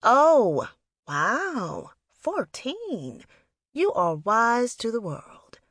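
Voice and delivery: exaggerated, swooping impressed tone; high, playful mock-impressed voice; teasing mock awe. A narrator's reading. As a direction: tease her with overdone amazement. Big sister teases the teen.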